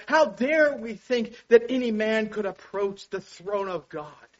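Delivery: ordinary delivery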